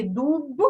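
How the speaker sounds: дуб ending in a released, voiced b; careful teacher's дуб with a distinct final b; voiced final consonant. Shown as a demonstration. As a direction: keep the б of дуб ringing, not p. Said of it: "також пишемо дзвінкий Б".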